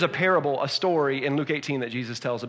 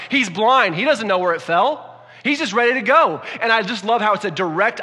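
higher and louder, building urgency; full-force preaching; 6 semitones higher, 6 dB louder than usual. Acted. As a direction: preach it, rising to a peak.

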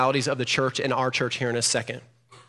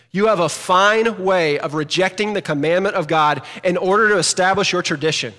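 emphatic reading; 4 semitones higher, 6 dB louder than usual. Shown as a demonstration. Pastor to church, reading Jesus' rebuke emphatically. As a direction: read it like an exclamation. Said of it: "I read that emphatically".

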